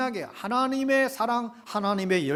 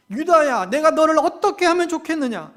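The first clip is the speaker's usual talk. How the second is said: intensified anguished plea; 6 semitones higher, 7 dB louder than usual. Acted. God's lament, repeated more strongly.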